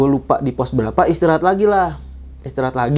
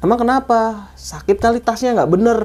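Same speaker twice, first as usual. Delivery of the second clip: concerned questioning; 8 semitones higher than usual. Retold or imitated. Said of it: "checking on hurt friend".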